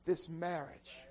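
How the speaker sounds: loud, high whooping sermon climb; preaching at full force. Real, not acted. Preacher whooping to the congregation, loud and high. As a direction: build the sermon to a roaring peak.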